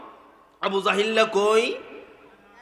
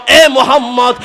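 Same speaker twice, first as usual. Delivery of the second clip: calling out; 10 dB louder than usual. Acted. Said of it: "ডাক দিয়ে বলল".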